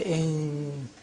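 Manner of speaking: slow "ink" with a held ng; n hidden before K. Shown as a demonstration. Teacher demonstrating ikhfa in English, drawn-out ng in "ink". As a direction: hold the n of "ink" at the K position. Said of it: "from the point of articulation of K".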